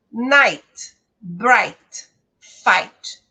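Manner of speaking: "Night", "bright", "fight" loud, each ending with a falling tune on ai; English pronunciation model. Shown as a demonstration. Teacher modelling English pronunciation for students, loud and careful. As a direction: say "Night", "bright", "fight" as crisp one-syllable words. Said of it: "a maneira como nós pronunciamos essa palavra".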